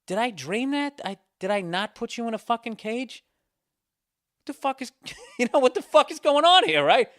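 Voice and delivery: hammed-up bewilderment at the dog; incredulous. Owner questions the loose dog, incredulous.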